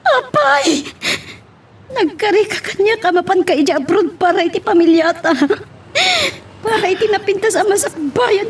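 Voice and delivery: high, crying reproach; tearful pleading. Acted. Betrayed wife crying at her husband, high and tearful. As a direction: sob out the betrayal.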